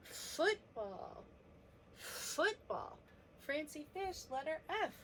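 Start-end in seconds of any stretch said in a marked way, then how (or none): 0.1-3.0 long hissing F before the rest of Football; stretched first sound. Shown as a demonstration. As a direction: draw out the F that starts Football. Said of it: "Do you remember what this sound".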